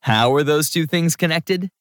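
mildly baffled; confused. A narrator's reading. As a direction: ask as if you can't see the link.

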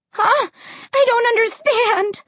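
high-pitched bewildered cry; crying out, shaken. A narrator's reading. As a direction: cry out in frightened confusion. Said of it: "my voice shaking".

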